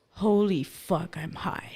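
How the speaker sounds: stunned. Retold or imitated. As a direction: let it dawn slowly, trailing to a whisper. Stoned realization retold.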